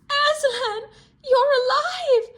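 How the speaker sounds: put-on very high, loud delighted girl; joyful cry. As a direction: cry out with relief as Lucy. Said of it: "Lucy cried".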